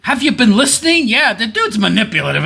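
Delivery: high, loud incredulous scolding; exasperated outburst. Real, not acted. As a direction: snap at the question as absurdly obvious. Exasperated at interviewer's obvious question.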